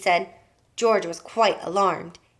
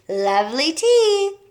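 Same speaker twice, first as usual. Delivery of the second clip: gloating; 7 semitones higher, 6 dB louder, slower than usual. A narrator's reading. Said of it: "grinning hugely".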